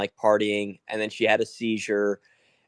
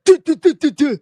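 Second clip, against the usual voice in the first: high, loud imitated "duh-duh-duh"; rapid mock spitting noise; 10 dB louder than usual. Friend imitates woman spitting in her seizure, high staccato mimicry. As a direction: fire off loud, high-pitched bursts of nonsense sound.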